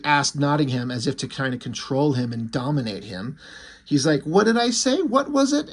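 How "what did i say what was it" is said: high, teasing faux-innocent question, lightly voiced; mocking villain. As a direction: toy with him, pitch it up innocently. Irons baiting Nottingham about money.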